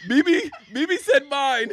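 normal talking voice

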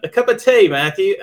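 high, loud mock English accent. Host calls Matthew to tea, mock English accent. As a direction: pipe it up in playful English. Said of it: "like, an English accent".

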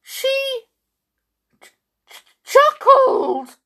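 high, spluttering outrage; stammer, then shriek the last word; appalled cry. A narrator's reading. King splutters at the chuckle, appalled cry. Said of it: "cried the king".

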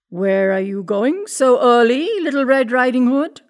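storybook wolf, loud and inquisitive; wolf questioning the girl. A narrator's reading.

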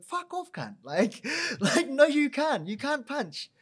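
high, laughing brush-off; laughing rebuff. Retold or imitated. Trainer laughs off the kid's request to fight, high and laughing. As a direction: laugh him off as a joke.

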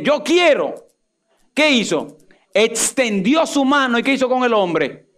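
high-pitched, fervent preacher's cry; impassioned pulpit proclamation, pitched high throughout; full-force preaching. Real, not acted. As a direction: build a driving, exalted sermon climax.

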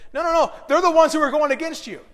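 loud, high urgent warning. Pretend. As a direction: plead it loudly and fast. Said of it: "he didn't say that".

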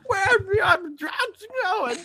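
high, slow bat screech. Retold or imitated. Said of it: "he's trying to sound like a bat".

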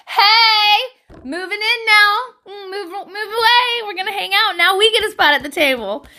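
high, excited hype-man crowing. Acted. Hype man celebrating a spot at the table, high crowing. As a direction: celebrate noisily for your friend. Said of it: "just be your hype man".

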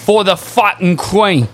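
mock British geezer accent; put-on British accent. Asking friends to hear him out, in put-on British. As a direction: make a speech in hammed-up British. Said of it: "not gonna be talking like this".

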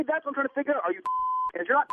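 ordinary talk

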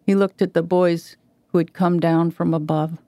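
ordinary delivery

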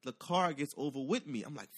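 usual speaking voice

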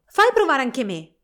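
più alto e più forte, Fritz esclama eccitato; esclamazione. A narrator's reading. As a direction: pretendi il giocattolo con foga. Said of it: "esclamò Fritz". (Fritz strappa lo schiaccianoci alla sorella, esclamando forte)

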